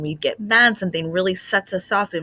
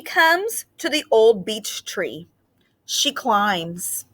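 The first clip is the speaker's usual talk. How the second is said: slow, expressive read-aloud with long pauses; reading to children; slower than usual. A narrator's reading. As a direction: read it gently to a class of kids. Teacher reads picture book aloud.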